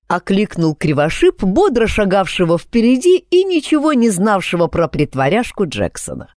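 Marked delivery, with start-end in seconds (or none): none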